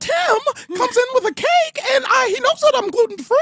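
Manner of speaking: very high squeaky cartoon mouse complaining; Mickey Mouse voice. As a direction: whine about the cake like the cartoon mouse. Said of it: "sounded like Mickey Mouse".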